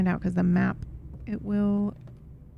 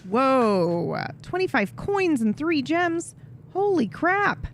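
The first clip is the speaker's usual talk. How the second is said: excited surprise; 7 semitones higher, slower than usual. Real, not acted. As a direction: voice jumps high in amazement. Finding coins and gems.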